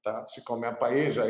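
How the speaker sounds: ordinary talk, nothing marked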